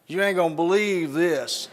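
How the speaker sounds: easy Southern "You ain't gonna believe this"; Southern drawl. Shown as a demonstration. Showing a Southern audience how they start a story, drawled. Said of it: "What do we say?".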